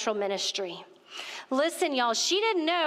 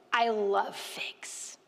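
breathy, hushed, slow whisper of delight; awed whisper; much slower than usual. Retold or imitated. Whispering back to the stranger offering figs, awed. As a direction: whisper it as if stunned by the gift. Stunned whispered reply to fig question.

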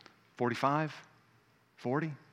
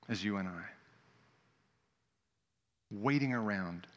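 tender preaching; 4 semitones lower, 6 dB quieter than usual. Real, not acted. Pastor telling the church God waits for them, soft and tender. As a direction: let it sink in gently. Intimate slow preaching close.

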